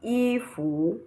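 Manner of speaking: "ifu" with long rising fu; high i, then slowly lift fu; wrong second tone. Shown as a demonstration. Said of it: "there is no tone on the U".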